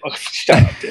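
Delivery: usual speaking voice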